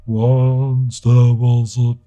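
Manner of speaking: imitating a deep voice, very slow and drawn out; slow mock crooner voice; deep-voiced singer. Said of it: "With the deep voice".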